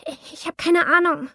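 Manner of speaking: high, loud frightened denial; panicked denial. Acted. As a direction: blurt it out in fear. Threatened heroine answers in panic.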